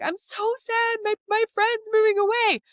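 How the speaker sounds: a sad little kid, voiced way up high; upset child. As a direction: mimic a kid wailing about their friend. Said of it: "your child comes to you and is like".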